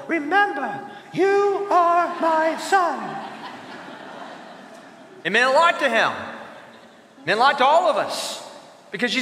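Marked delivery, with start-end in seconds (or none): 0.1-3.2 high, slow kid imitating a lion; tiny child grandly quoting a movie line; little four-year-old. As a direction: pitch it up into a small child's solemn voice. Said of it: "the little four-year-old".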